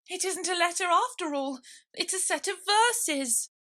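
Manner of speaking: high, flustered rabbit voice. Acted. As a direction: squeak it out in a hurry.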